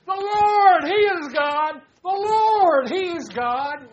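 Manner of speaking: high, loud shouted praise; fervent shout of praise to the Lord; crowd shout. Retold or imitated. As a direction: cry it out like a whole nation rejoicing. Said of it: "the whole nation is shouting".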